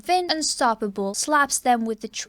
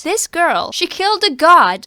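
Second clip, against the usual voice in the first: louder, higher, in awe; excited shout; 6 semitones higher, 10 dB louder than usual. Real, not acted. Fan proclaiming Vin killed a god, loud and high. Fan exclaiming over Vin's victory.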